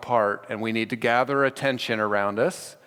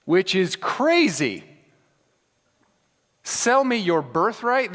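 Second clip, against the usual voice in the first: disbelieving, higher and slower; can't believe what he's hearing; incredulous; 6 semitones higher, slower than usual. Real, not acted. Incredulous at Jacob's demand.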